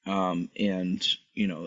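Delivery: ordinary talk, nothing marked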